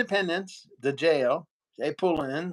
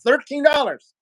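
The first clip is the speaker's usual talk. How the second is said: higher and louder, outraged at "Thirteen dollars!"; indignant exclamation; 7 semitones higher, 7 dB louder than usual. Real, not acted. Telling a friend about the absurd fine, indignant and loud. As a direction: spit out "Thirteen dollars!" in disbelief.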